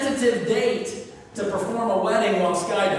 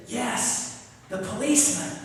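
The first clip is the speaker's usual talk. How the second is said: put-on whispering child; 2 semitones higher, 4 dB quieter than usual. Child whispers answer on phone.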